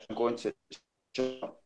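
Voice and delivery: "I'm going to shop" with "the" cut to a glottal stop after "to". Shown as a demonstration. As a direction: cut off sharply after "to", pause, then "shop". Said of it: "we cut it off".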